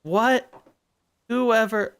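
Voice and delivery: high startled shout; sudden yell. Real, not acted. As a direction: blurt it out loud. Sudden loud outburst.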